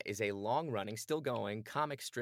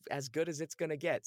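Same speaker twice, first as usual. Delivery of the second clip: casting director voice; 7 semitones higher than usual. Retold or imitated. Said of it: "they laughed and they said".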